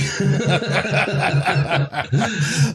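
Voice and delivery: a long, high, real laugh. Real, not acted. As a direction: burst out laughing at the joke. Laughs at the Seinfeld joke.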